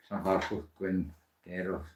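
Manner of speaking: very slow, careful Llanfairpwllgwyngyllgoch; attempted Welsh name. Shown as a demonstration. Vlogger trying the longest Welsh place name, slowly sounding it out. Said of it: "I still can't pronounce".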